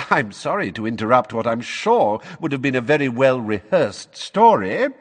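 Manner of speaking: supercilious. Acted. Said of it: "a very supercilious look on his face".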